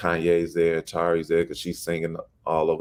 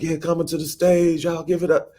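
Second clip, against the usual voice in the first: high, hyped stage announcer; practicing a stage intro in the basement; mock emcee intro; 12 semitones higher than usual. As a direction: hype the crowd like a host. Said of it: "introducing myself, practicing".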